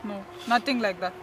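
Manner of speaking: mother softly soothing her crying son; soft comforting. Acted.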